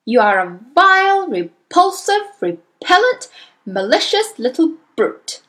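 loud tirade swooping up high; shouted abuse, pitch leaping up; angry shouting. A narrator's reading. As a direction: hurl each insult like a furious headmistress. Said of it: "the Trunchbull was shouting".

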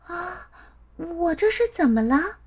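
put-on high, puzzled child voice; uneasy little girl. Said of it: "她莫名地感到一阵心慌".

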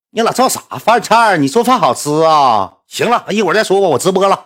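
high, loud, exasperated retort; irritated husband. Retold or imitated. Husband brushing off wife's dinner idea, irritated.

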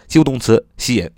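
ordinary talk

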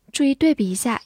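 normal talking voice

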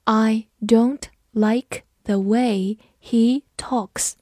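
very slow, one word at a time; slow, careful model reading; slow read-through. Shown as a demonstration. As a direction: read it slowly for learners to follow, pausing between words. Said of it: "跟着我慢读一遍".

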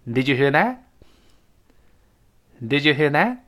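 "Did you hear that?" rising on "that"; question intonation. Shown as a demonstration. Teacher modelling a yes-no question for students, rising tune on "that". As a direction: lift the pitch at the end on "that". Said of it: "一般疑问句注意声调啊".

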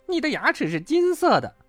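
very high, amazed asking; high-pitched disbelief; astonished question. A narrator's reading. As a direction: gasp the question in wonder. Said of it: "跳跳诧异地说".

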